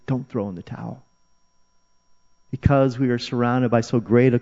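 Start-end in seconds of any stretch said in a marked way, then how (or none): 0.1-1.0 hushed encouragement. Acted. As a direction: bring the rallying cry right down. Shouted appeal repeated softly.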